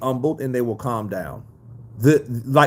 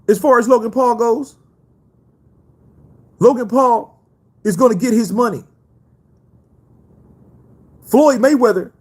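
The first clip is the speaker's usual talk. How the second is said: higher, louder boastful boxer voice; a higher-pitched Mayweather press statement; Floyd Mayweather impression; 10 semitones higher, 5 dB louder than usual. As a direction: address the rumors like a cocky champ. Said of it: "[name], do Floyd".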